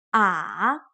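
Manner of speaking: "Ah" dipping low, then rising; drawn-out "Ah" with a down-then-up pitch; third tone. Shown as a demonstration. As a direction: let "Ah" sink and climb back up. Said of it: "dips down".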